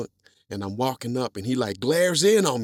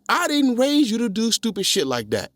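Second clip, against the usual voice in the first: higher and louder stern rebuke; tough-love scolding; 11 semitones higher, 6 dB louder than usual. Retold or imitated. Dad staring his son in the eye, stern rebuke. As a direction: lay down the law like a strict dad. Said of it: "he says".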